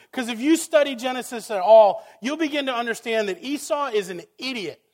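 loud, high, protesting cry to God; impassioned preaching. Acted. Preacher challenging God before the congregation, impassioned and loud. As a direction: preach it at full force, arguing with heaven.

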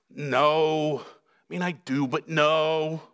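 low, half-hearted no with hesitation; mild polite refusal. Acted. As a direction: decline reluctantly, as if tempted. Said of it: "This is not".

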